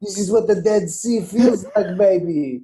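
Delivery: slow, coaxing mock Israeli seller accent; lightly voiced, a hint of the salesman selling the lotion; Israeli kiosk seller. Seller rubbing lotion on a shopper's hand, light Israeli accent. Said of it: "they go".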